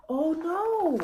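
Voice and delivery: gasping high oh no; gasp, then a high worried oh no; sudden alarm; shocked gasp. Acted.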